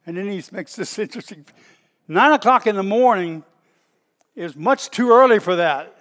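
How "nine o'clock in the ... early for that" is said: much louder, emphatic declaration; shouting to a crowd. Acted. Peter rebutting the crowd's assumption, loud and emphatic. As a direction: proclaim each phrase forcefully. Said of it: "shouted to the crowd".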